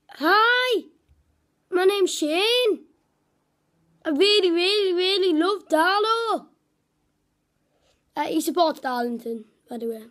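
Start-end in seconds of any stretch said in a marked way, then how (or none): 0.1-6.5 higher, slower put-on northern voice; imitating Shane's broad northern accent. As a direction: lay the northern accent on thick. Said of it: "he's got a pretty northern voice".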